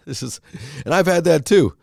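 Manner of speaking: ordinary delivery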